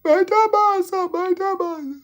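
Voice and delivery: high, slow, loud gushing thanks. Real, not acted. Vlogger thanking his live donors, high and gushing. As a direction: pour out the thank-you.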